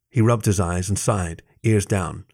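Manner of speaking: ordinary delivery, nothing marked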